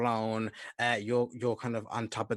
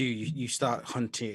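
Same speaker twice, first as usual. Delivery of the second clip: ordinary talk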